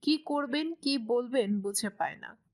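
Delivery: ordinary delivery, nothing marked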